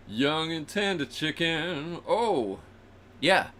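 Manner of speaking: slow savoring predator voice; slow gloating hawk savoring the meal; hungry hawk. Acted. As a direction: relish it like the hawk. Voicing hawk eating chicken.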